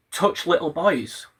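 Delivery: ordinary talk, nothing marked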